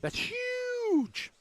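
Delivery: high, squeaky, amazed "that's huge". Real, not acted. Host marvels at the size of the Blue Mountains. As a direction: jump way up in pitch in awe. Reacting to Grand Canyon fact.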